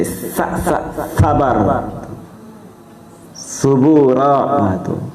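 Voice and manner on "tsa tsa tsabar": "sabar" begun with a lisped th-like sound, not plain s; sound demo on "sa". Shown as a demonstration. Preacher showing listeners the name's first letter, demonstrated "sabar" with th-like onset. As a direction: repeat "sa", then say "sabar" with that th-like onset. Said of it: "Pakai sa".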